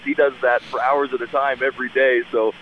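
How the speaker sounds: ordinary delivery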